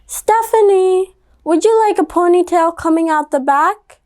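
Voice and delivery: lightly voiced mother asking her daughter; mom voice. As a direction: coax her like a patient mom. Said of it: "the mom said".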